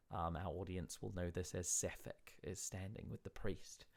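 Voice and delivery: ordinary talk, nothing marked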